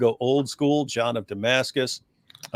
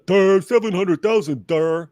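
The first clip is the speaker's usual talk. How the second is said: dopey "duh" voice, a bit higher and louder; mock the critic as a dimwit; mocking dumb voice; 5 semitones higher, 4 dB louder than usual.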